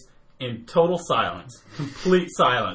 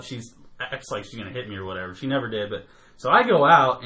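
ordinary delivery

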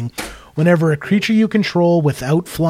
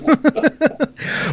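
high run of laughter; amused laugh; 12 semitones higher than usual. Real, not acted. Joking about fun but bad combos, high amused laugh. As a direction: laugh at your own quip.

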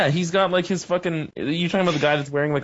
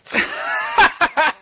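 snake hiss; 13 semitones higher, 4 dB louder than usual. Retold or imitated. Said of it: "the snake and the tss".

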